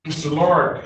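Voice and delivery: ordinary talk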